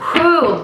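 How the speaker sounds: high, short falling "whew". Real, not acted. Reader seeing the transformation card, high whew. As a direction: exhale a high whew of relief.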